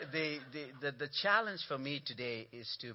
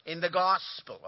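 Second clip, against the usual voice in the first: loud, high call of In the gospel; louder response; 6 semitones higher, 9 dB louder than usual. Real, not acted. Preacher calling the church to answer louder, loud. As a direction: lead the congregation, voice raised. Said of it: "Say it a little louder".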